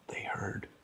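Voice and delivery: usual speaking voice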